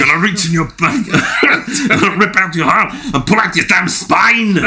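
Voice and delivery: mock action-hero threat through laughter; Schwarzenegger impression. Schwarzenegger quotes impression round.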